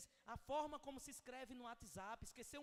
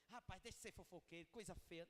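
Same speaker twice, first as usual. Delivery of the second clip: a hint of a curt, softer scolding rebuke; harsh scolding; 4 semitones lower, 4 dB quieter than usual. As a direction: mildly scold him for gossiping. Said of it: "Não vai dizer". (leve tom de bronca ríspida)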